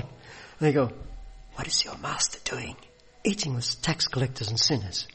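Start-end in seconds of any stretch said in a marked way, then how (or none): 1.5-5.1 hushed conspiratorial whisper. Retold or imitated. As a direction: whisper it so the master won't hear. Pharisees whispering to the disciples.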